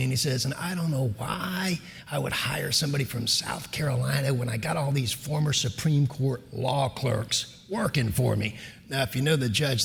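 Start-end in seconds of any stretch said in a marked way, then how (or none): 0.4-8.6 slow, soft, deliberate put-down; judge's voice. Retold or imitated. Judge doubting the South Carolina candidate, slow and soft. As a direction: impersonate a judge, quiet and drawn out. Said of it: "that's exactly how he talks".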